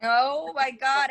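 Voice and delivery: drawn-out, high, loud oh my God; excited groan. Real, not acted. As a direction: let it stretch out in mock horror. Reacting to the eyeliner jokes.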